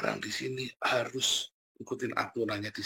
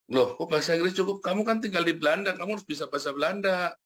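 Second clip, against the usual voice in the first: raised pitch, retold protest; mock incredulous rebuke; 7 semitones higher than usual. Retelling a rebuke to someone living in Belanda, mock incredulous. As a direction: imitate someone objecting in surprise.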